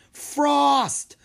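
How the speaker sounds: high, loud yell of a dragon's name. A narrator's reading. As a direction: shout across the hall. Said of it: "Mina yelled".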